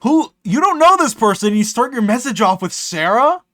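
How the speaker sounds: high, loud shocked outburst; disbelief. Real, not acted. Reading a stranger's message, shocked and loud. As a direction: blurt it out, stunned at the message.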